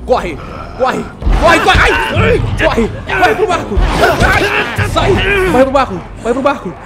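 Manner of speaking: frantic yelling to run; panicking gamer. Real, not acted. Fleeing zombie horde to boat.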